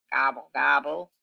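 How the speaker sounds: a low grumbled gobble, gobble; grumbling gobble. Acted. Turkey sulking after Cow sees through him, low grumble. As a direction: mutter it like a sulky turkey. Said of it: "grumbled Turkey".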